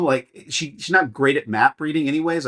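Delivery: normal talking voice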